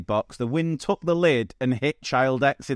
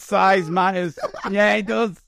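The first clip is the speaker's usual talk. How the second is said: hammed-up voice; 7 semitones higher than usual. Joking innuendo voice.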